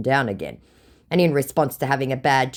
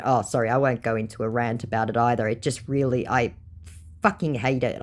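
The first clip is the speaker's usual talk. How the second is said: ordinary talk, nothing marked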